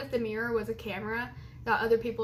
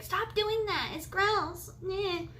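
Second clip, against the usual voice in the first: mocking family; 8 semitones higher, slower than usual. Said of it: "my whole family is like".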